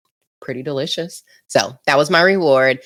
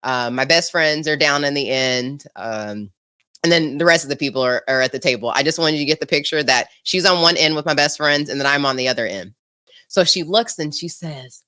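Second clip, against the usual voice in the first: ordinary talk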